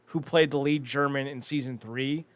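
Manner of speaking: normal talking voice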